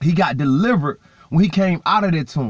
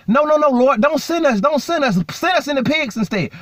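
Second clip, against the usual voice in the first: higher-pitched, lightly acted begging; mock scared pleading; 7 semitones higher than usual. Fallen angels begging the Lord not to send them, mock scared pleading. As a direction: plead in repeated bursts, acting mildly scared. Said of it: "they are scared of hell".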